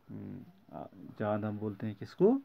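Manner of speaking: normal talking voice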